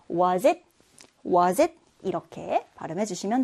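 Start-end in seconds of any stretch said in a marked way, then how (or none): 0.1-1.7 WAS IT run together as "wuh-zit"; linked z sound. Shown as a demonstration. Teacher modelling for learners to copy, linked z. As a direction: link "was it" with a z into the vowel. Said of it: "was he와 마찬가지로 z 발음으로".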